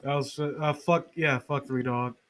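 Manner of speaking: usual speaking voice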